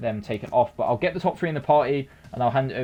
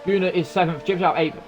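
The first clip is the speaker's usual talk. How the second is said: raised pitch, driving race-finish call; live race commentary; 6 semitones higher than usual. Real, not acted. Commentator calls the Monaco winner to viewers, excited race call.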